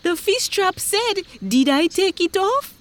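very high, urgent retelling; worked-up high-pitched fisherman; agitated fisherman. Acted. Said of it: "the fisherman continued".

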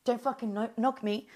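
put-on taunting voice; retold taunt. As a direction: mimic their jeering oh's. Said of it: "like this".

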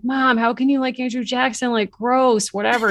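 teen daughter mock. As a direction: scoff at mom like a teenager.

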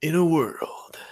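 whispered movie trailer voice; breathy epic trailer narrator. Pretend. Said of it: "I think I can do that trailer voice".

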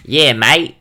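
loud, high mock Australian "Yeah, mate"; hammed-up Australian twang; Australian accent. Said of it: "and then he'll go".

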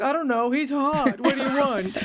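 playful impersonation, husky and rounded. Acted. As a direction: put on a raspy, rounded star voice. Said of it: "I love your [name] voice".

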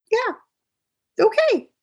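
high, drawn-out cautious okay; wary okay. Retold or imitated. Husband backing off warily.

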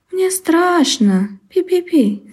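high, slow scared mouse voice; frightened squeak. Acted. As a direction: squeak it out, trembling with fear. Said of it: "запищал мышонок".

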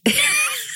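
sudden high laugh; burst of laughter. Real, not acted. Hosts crack up over vampires.

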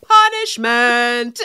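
very drawn-out, swooping mock decree; mock sing-song verdict. Co-host imitates friend punishing her in the car, sing-song. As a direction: stretch each syllable out in sing-song. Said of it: "you'd go".

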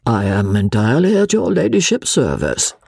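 ordinary delivery, nothing marked

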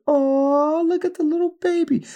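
put-on high cooing over a baby. Pharaoh's daughter finding the baby in the basket, high coo. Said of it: "she like".

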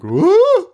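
very high hooted "whoo" sound; exaggerated cartoon hoot, shooting up in pitch; mock goofy whoa. Mocking Tim Curry's visual gags, high hammed-up hoot. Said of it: "constantly just being like".

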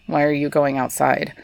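ordinary talk, nothing marked

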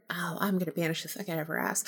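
hushed, quick mock menace; fast whispered threat. To a spirit invading her home, a fast whisper.

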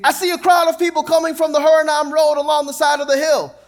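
high, loud lookout's cry; watchman calling out. Acted. Watchman on the wall crying out to the king, high and loud. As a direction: shout it down from the wall.

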